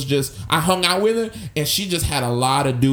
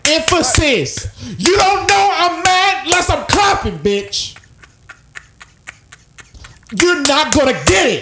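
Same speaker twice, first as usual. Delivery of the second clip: high, loud, punched word by word; shouted emphasis; 12 semitones higher, 5 dB louder than usual. Retold or imitated. Imitating a mad woman clapping for emphasis, shouting. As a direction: yell it as if clapping on each word. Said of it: "emphasis. Always emphasis".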